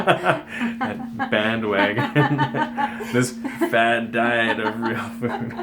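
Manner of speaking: laughing through the words, slow; giggling while mocking the fad. Real, not acted. Joking about real food fad.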